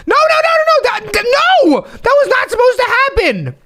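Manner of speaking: high, frantic stammering protest; panicked denial. Acted. Host horrified that the gift blew up, panicked. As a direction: splutter in horror, voice shooting up.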